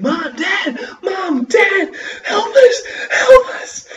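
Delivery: high, loud, sobbing calls for help; crying desperate shouts. A narrator's reading. Boy running to his parents, crying and shouting for help. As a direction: scream for them through tears.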